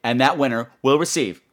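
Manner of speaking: usual speaking voice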